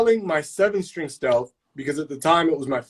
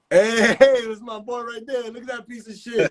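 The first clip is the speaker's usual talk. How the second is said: laughing callout; 7 semitones higher than usual. Real, not acted. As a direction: call out to the buddy through laughter. Calls out friend on chat.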